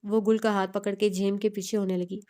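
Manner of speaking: ordinary delivery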